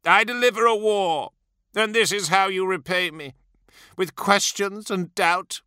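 high-pitched, aggrieved questioning; hurt tyrant's bitter complaint; anguished reproach. A narrator's reading. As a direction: accuse him of ingratitude, deeply wounded. Wounded lord reproaches his officer.

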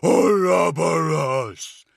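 high, loud, slow creature voice; drawn-out otherworldly snarl; spitting monster. A narrator's reading. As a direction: spit the name out as a huge demon. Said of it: "it spat".

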